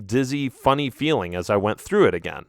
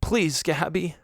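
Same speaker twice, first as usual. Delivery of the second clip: soft pleading cry; 5 semitones higher, 5 dB quieter, slower than usual. Acted. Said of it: "I cried softly".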